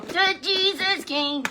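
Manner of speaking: high, loud, slow puppet voice. Acted. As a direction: puppet sidekick announcing.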